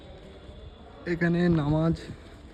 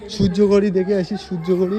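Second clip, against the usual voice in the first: calling out; 4 semitones higher, 9 dB louder than usual. Real, not acted. Calling out to companion.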